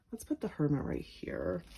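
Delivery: soft whisper to herself; murmured under the breath; whispered aside. Real, not acted. Reader laying a card, soft whisper.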